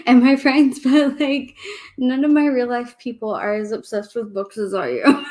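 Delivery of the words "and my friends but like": laughing, a bit louder. Real, not acted. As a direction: laugh through the words. Laughing about her book obsession.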